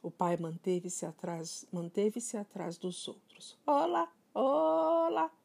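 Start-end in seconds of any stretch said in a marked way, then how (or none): 3.7-5.3 high, slow drawn-out parrot greeting. Acted. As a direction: stretch each olá like a parrot. Parrot voice again.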